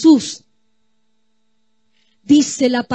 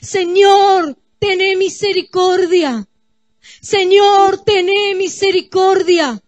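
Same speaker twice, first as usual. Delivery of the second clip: súplica en voz alta y más aguda; clamando; 5 semitones higher, 4 dB louder than usual. Acted. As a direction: clama a Dios pidiendo misericordia. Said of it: "clamando, gimiendo, orando". (clamando en oración con fuerza)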